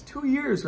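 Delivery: "Two years" pitched high with disbelief; exasperated emphasis. Real, not acted. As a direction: lean hard on "Two years".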